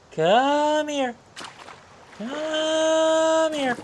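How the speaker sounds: high sing-song fish coaxing; talking to fish. Real, not acted. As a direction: croon it high and drawn out.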